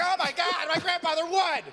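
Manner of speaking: very high squealed recruit voice; high-pitched mock wail; mock distraught recruit. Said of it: "And they're like".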